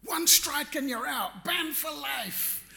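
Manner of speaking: husband arguing with his wife over the race, indignant and rising; retold indignant protest. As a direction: argue hard that he shouldn't have raced. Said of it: "And I'm going".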